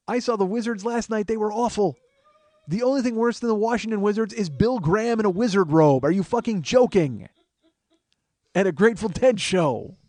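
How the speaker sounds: high, loud mock incredulity; hammed-up outburst. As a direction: rant as if utterly appalled, played for laughs. Hammed-up rant about wizard robe.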